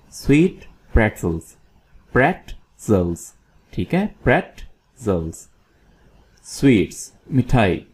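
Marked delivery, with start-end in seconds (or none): 2.1-3.3 "प्रेटजल्स" slowly in two parts, PRET, zels; syllable by syllable. Shown as a demonstration. Teacher breaks word into syllables. 4.2-5.5 slow, split "प्रेटजल्स", PRET then zels; syllable by syllable. Shown as a demonstration. Teacher breaks word into syllables.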